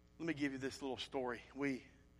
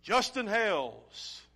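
slow, loud, high summons; loud, slow summons to come forward; calling a name; 6 semitones higher, 10 dB louder, much slower than usual. Pretend. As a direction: call the name out like a heavenly roll call. Imagining being called to glory.

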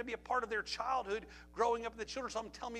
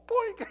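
a high, loud cartoon boink; playful sound effect for the pole; 9 dB louder than usual. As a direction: pop out a high comic bonk noise. Walked child into a pole.

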